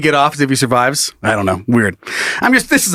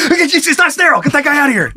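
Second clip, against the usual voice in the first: panicked laughing yell; 10 semitones higher than usual. Pretend. Mock staff reacting.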